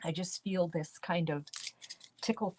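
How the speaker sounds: talking around a candy; words round a sucked candy; candy in mouth. Real, not acted. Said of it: "I'm gonna put a candy in my mouth".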